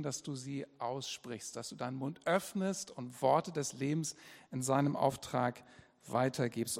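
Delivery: ordinary talk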